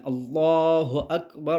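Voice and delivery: slow, drawn out আল্লাহু আকবার; takbir call. Shown as a demonstration. Teacher showing learner the takbir before bowing, slow. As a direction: intone it like in prayer.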